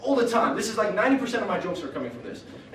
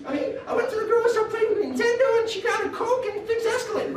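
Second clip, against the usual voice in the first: put-on very high squeaky kid bragging; little Joey. Little Joey bragging to his classmates, squeaky high boast. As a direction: squeak it out like a proud little boy. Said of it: "he's like".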